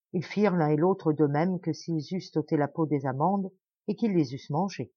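ordinary delivery

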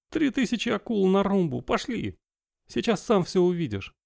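high, hushed pirate wife; pirate woman voice. Acted. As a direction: mutter the oath like a salty sailor.

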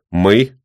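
"Мы" said loud and weighty; stress on мы. Acted. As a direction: lean hard on "Мы" as a stern old man. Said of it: "делает акцент на этом слове".

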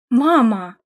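protesting outcry with some force; indignant. A narrator's reading. Daughter protests at mother's reproach, indignant. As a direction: object sharply to being belittled. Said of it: "возмутилась Ада".

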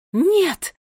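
high, loud cry of no; alarmed scream. A narrator's reading. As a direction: shout to stop her at once. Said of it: "одновременно закричали королева Кникс и Гвардиния". (испуганный громкий крик)

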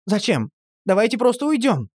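a mild exclaimed plea; mild exclamation from Dima to the family; exclaiming. A narrator's reading. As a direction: blurt out the wish to leave. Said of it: "воскликнул Дима".

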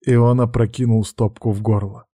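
usual speaking voice

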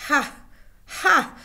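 loud, high burst of scorn; a loud, high scoffing cry; sharp exclamation. A narrator's reading. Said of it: "esclamò Legree tra sé".

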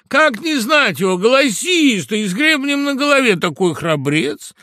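gushing admiration. A narrator's reading. Fox flattering the hidden rooster, gushing admiration. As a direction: sing his praises extravagantly.

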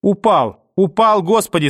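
high, loud cry of alarm; horrified cry. Acted. A woman cries out that the boy has fallen, high and loud. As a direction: cry out in horror. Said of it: "далёкие крики".

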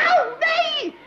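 high, loud yelp with a laugh; pained cry. Acted. Patient squeals at the doctor in pain. Doctor works on her toe.

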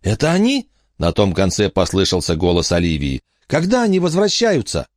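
put-on eager small girl pressing for news; little girl on phone. Olivia asks on the phone.

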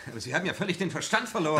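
fast frightened outcry at the countdown; panicked protest. Acted. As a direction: gabble it out in panic.

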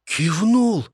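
sniffling younger son. A narrator's reading. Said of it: "шмыгнул носом".

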